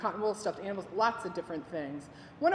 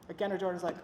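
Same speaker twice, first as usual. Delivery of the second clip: normal talking voice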